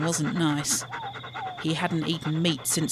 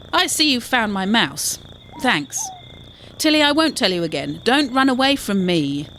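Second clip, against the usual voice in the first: a bit higher and louder, scolding a runaway pet; pretend pet owner; 5 semitones higher, 5 dB louder than usual. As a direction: call the pet back like a mildly cross owner. Quinn pretends the mouse is his.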